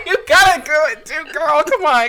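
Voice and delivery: high, loud, laughing urging. Real, not acted. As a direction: coax them through real laughter. Both helpless with giggles.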